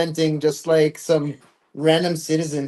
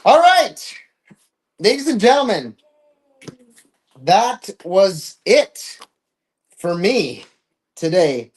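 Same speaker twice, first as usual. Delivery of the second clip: host sign-off; 5 semitones higher, 5 dB louder than usual. Real, not acted. Signing off the stream.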